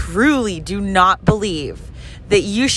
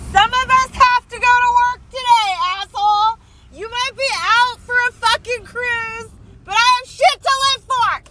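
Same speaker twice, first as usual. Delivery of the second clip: worked-up road rage. Real, not acted. Mom late for work yelling at a cruising driver, furious shout. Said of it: "Look, I got myself all worked up".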